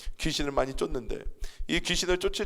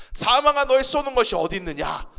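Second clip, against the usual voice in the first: triumphant cry; 7 semitones higher, 5 dB louder than usual. Acted. Triumphant proclamation against death.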